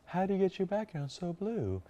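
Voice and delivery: high, put-on amazed client; mock client voice. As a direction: gush the question like an impressed client. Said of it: "taking that voice on whenever I talk about my clients".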